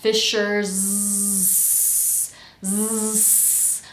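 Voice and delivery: "Fishers" with long Z turning into S; voice on, then off. Shown as a demonstration. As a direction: buzz the end of FISHERS, then cut the voicing to a hiss. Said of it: "Notice how my voice turns on and then off".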